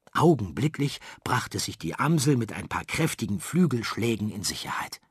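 ordinary delivery, nothing marked

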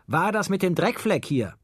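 high, accusing call from the kitchen; father demands an answer from another room. A narrator's reading. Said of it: "rief er aus der Küche". (vorwurfsvoll aus der Küche gerufen)

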